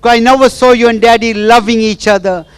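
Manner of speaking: high, loud, pleading and tearful; tearful plea to mother. Retold or imitated. Wife pours out her hurt to her absent mother, tearful and loud. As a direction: cry out to the mother who left her. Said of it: "And she was crying".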